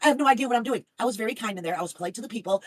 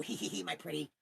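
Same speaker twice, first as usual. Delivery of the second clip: quiet cackling hag voice; soft witch cackle; 3 semitones lower, 10 dB quieter than usual. Pretend. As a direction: cackle softly like a storybook witch. Witch cackle after rant.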